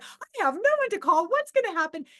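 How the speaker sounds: high, loud dismayed wail; acted-out helpless panic; retold panic. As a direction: exclaim it in rising alarm, re-enacting helplessness. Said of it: "independence just went totally out the window".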